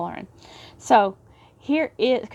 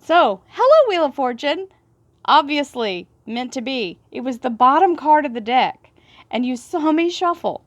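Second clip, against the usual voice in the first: high and loud, thrilled greeting to the card; excited hollering; 5 semitones higher, 5 dB louder than usual. Real, not acted. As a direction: gush at the card like it showed up on cue. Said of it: "So sorry for screaming and hollering".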